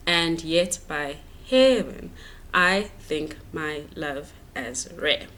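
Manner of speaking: "And yet by heaven, I think my love as rare" slow and evenly beaten; measured syllable reading. Shown as a demonstration. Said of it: "made it into one syllable".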